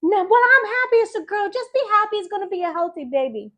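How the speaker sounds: high, insistent mom voice; mother's high-pitched reassurance, speeding up; imitating mom. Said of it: "And she said".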